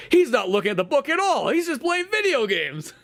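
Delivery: high-pitched indignant tattletale, stretching the last words; tattling girl. Acted. Classmate exposing the gamer to the group, tattling girl. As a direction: expose the culprit in a high mock outcry.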